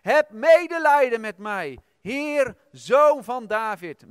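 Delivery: loud pleading cry, slow on zoon van David; crying out for mercy. Retold or imitated. Mother begging Jesus to save her daughter, loud desperate cry. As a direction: call out desperately to the Lord. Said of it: "klonk er dus een geschreeuw".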